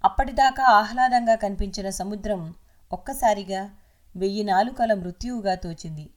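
usual speaking voice

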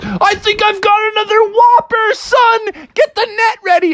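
high, eager call for the net; thrilled at another whopper; excited dad. Acted. Dad calling son to ready the net, high excited. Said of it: "And Froggy's dad was like".

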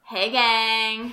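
loud bro greeting; frat bro. Shown as a demonstration. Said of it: "I was talking in my bro voice".

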